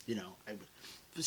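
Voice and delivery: breathy hushed confession, low and soft; whispered aside. Pretend. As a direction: let it slip as a secret whisper. Whispered aside about being rock star.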